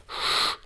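long hissed "shh"; anxious shushing. A narrator's reading. Distraught old man silencing the boy, anxious shush. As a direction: hush them with a soft hiss. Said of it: "sah ganz verstört aus".

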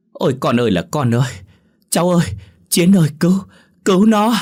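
very high, loud wailing cries for help; desperate shouting. Acted. Father begs the young man to save his daughter, desperate shouting. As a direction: howl for help, building to a scream. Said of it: "hô lớn".

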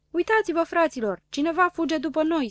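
high, excited cry; calling out. A narrator's reading. Gogoașă spots something following them, calling out. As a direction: shout a warning to everyone. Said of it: "strigă el".

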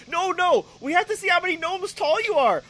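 a hint of a doting father; insistent dad. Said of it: "the kid's eighteen".

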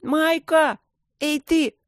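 сдержанно сыгранный высокий оклик; окликни девчонку издалека, высоким голосом; крик во всю глотку. A narrator's reading. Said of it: "во всю глотку крикнул". (изображая крик, зови протяжно и высоко)

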